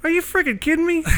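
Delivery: high, amazed cry of disbelief; stunned disbelief. Retold or imitated. Knife maker hearing his friend really is with Garth, stunned disbelief. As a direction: squeak it out like you can't believe your ears.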